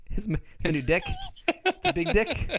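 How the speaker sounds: put-on New Zealand accent. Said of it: "pretty bad New Zealand accent".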